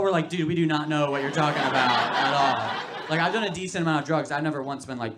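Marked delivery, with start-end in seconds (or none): 0.3-2.8 loud mock protest. Audience of addicts to the speaker, a loud laughing protest. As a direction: insist you have no idea, loudly. Crowd voiced protesting loudly.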